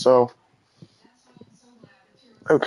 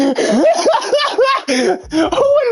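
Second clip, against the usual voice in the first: shrieking laugh. Real, not acted. Laughing at the game.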